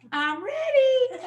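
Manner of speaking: put-on high, slow little-boy sing-song; child's voice. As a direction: play the small boy announcing he's ready. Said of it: "she hears him say".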